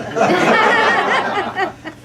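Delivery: loud high burst of laughter; real amused laugh. Real, not acted. Audience-free own laugh.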